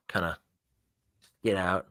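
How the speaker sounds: ordinary talk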